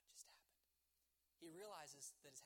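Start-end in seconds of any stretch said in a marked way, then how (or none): 0.1-0.6 stunned, breath-only whisper; hushed disbelief, trailing to almost nothing; shocked hush. Acted. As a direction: whisper it as if struck dumb with shock. Man finds his house gone, shocked.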